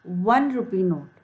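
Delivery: ordinary delivery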